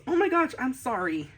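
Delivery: high-pitched cartoon apology; voiced as a sorry little fox; apologetic fox. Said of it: "And she says".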